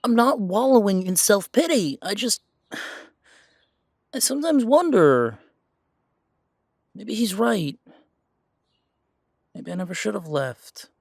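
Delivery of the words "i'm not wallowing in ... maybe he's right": defensive outburst. Acted. Defensive reply to his partner.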